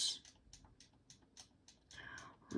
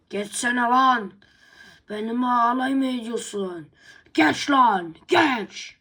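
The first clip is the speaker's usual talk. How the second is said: loud, higher shouted dare; loud and higher, daring him to fight; angry dare; 3 semitones higher, 9 dB louder, slower than usual. Acted. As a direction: bark the challenge at him.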